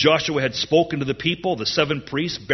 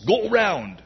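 high-pitched command, falling off; marching order; 7 semitones higher than usual. Acted. God sending His people round the walls, acted order. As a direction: send them off round the walls.

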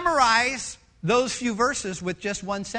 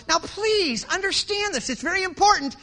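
preacher voice; 8 semitones higher, 4 dB louder than usual. Retold or imitated. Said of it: "It's not like here I am going".

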